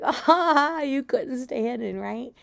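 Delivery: high, loud laugh easing down into teasing; mock laughing taunt. Brother teasing his brother in hide and seek, laughing. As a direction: crow it with an acted laugh. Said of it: "he starts to laugh like".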